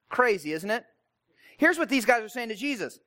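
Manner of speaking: ordinary talk, nothing marked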